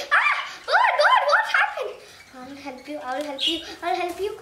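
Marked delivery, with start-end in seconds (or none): 0.1-1.9 high, loud alarmed cry; panicked shout at the fallen bird. Real, not acted. Bird falls, child alarmed.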